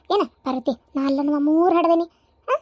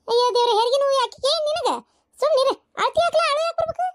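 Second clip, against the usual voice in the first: high-pitched scolding of the crying husband; exasperated scolding; 8 semitones higher than usual. Acted. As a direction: tell him off in a rising, high voice. Elder woman scolds crying husband. (ಗದರಿಸುವ ಏರು ದನಿ)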